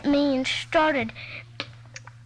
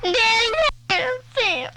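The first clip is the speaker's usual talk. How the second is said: high whiny cry; 6 semitones higher than usual. Real, not acted. Little girl whining to her daddy during a squabble. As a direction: whine for Daddy.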